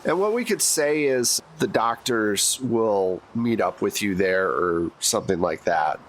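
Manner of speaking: ordinary delivery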